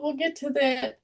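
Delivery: usual speaking voice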